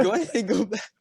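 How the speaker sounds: high imitated instructor voice; retold order, high and goading; mock instructor order. Instructor sends the team back.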